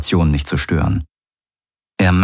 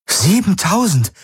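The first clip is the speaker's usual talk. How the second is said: Siebentausend cried out much higher, stunned; astonished exclamation; 13 semitones higher than usual. Acted. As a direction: blurt out Siebentausend in disbelief. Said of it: "rief Kirai aus".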